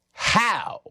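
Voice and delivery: high-pitched, questioning disbelief; incredulous. Acted. Comic questioning a claim of superiority, incredulous. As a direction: throw it up high, baffled.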